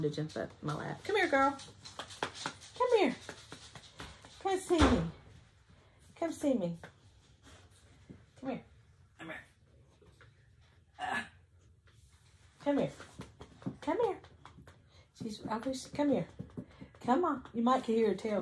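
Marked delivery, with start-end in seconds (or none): 1.0-14.2 high coaxing call to a dog. Real, not acted. 15.9-17.4 high coaxing call to a dog. Real, not acted.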